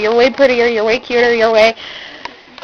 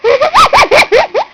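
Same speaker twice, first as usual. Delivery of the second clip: laughing shout; 14 semitones higher, 4 dB louder than usual. Real, not acted. Laughing fit at friend.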